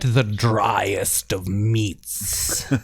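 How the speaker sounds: slow, haughty aristocrat; snooty rich man. Pretend. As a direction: look down your nose at everyone. Mocking rich people's tastes.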